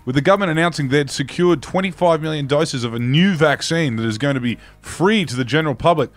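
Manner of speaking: ordinary talk, nothing marked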